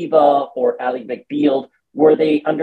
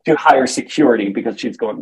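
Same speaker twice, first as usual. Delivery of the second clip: ordinary talk, nothing marked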